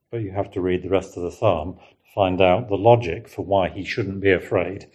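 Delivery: ordinary delivery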